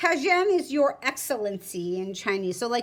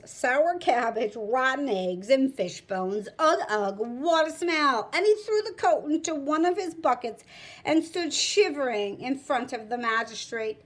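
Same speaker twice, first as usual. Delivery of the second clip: usual speaking voice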